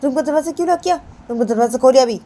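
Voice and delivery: high, loud, angry scolding; high indignant shout at a roommate; Payal scolding. Acted. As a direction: demand she open the door, shrill and loud. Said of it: "तो पायल पूनम को बोलती".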